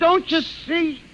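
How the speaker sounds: high, slow, pleading; patient begging the doctor to understand; desperate plea. Acted. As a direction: stretch it out, begging.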